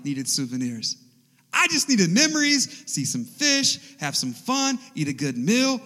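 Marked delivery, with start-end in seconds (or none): 1.5-5.8 loud, high-pitched mock protest; playful exasperation, starting high and loud. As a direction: protest playfully, punching each item. Mock complaint about wife's souvenir shopping.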